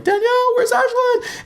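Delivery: high, frantic cry for his wife; panicked call. Retold or imitated. Father panicking, lost daughter.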